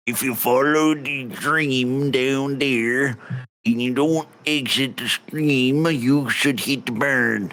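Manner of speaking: put-on thick rustic accent with stretched vowels; accented local. Local asks a traveller about bandits, rustic accent. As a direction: play a folksy old-country local. Voices a non-player character.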